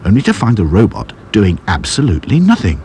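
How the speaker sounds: plain reading voice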